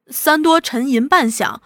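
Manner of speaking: plain reading voice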